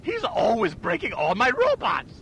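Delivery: high, slow cartoon villain whine; whining villain. Villain griping to a clerk about his robots, whining. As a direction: wail it like a thwarted villain.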